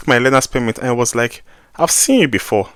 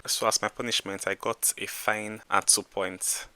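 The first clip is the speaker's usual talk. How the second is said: much softer, rueful; 3 semitones lower, 14 dB quieter than usual. Real, not acted. Telling viewers about the fine, softly rueful. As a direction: let the voice sink as it winds down.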